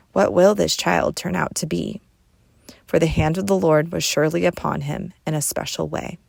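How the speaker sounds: normal talking voice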